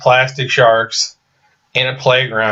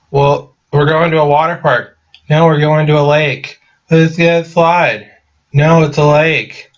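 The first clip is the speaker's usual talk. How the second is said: higher, louder mock argument between two girls; mock bickering friends; 5 semitones higher, 4 dB louder than usual. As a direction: ham up a silly back-and-forth squabble. Girls bickering over water park.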